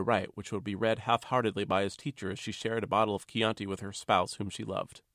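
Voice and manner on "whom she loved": usual speaking voice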